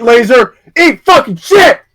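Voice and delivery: mock yell. Mock yelling at friend.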